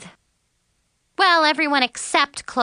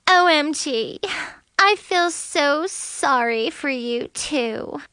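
fake tearful; 5 semitones higher, slower than usual. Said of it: "phony tears".

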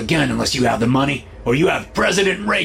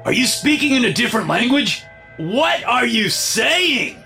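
captor yelling at a hostage; savage yell. A narrator's reading. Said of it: "at his savage yell".

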